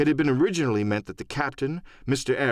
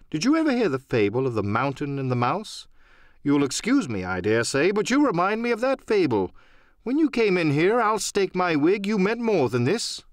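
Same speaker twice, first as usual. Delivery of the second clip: lightly voiced doctor, higher, amused; a hint of a wry gentleman poking fun, pitched up; doctor needling the captain, higher and light; teasing doctor; 6 semitones higher than usual.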